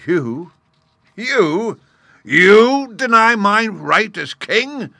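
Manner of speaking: outraged roar, high and very loud; furious. Acted. Lion challenged on his right as king, furious. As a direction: build from loud disbelief to a slow, towering bellow. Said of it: "worked himself into a perfect fury".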